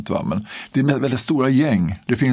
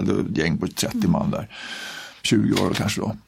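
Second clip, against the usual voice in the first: ordinary delivery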